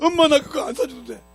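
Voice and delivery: put-on crying child. Lost boy running around crying for his mother, high wail. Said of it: "울고 다니면 막".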